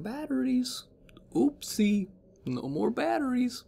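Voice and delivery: high, whispery mock pity; mock baby voice. Mocking the stranded family, high teasing coo.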